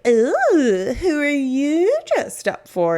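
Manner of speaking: high, slow, singsong teasing; teasing mock voice. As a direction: mimic nosy people ribbing her about dressing up. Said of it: "people are like".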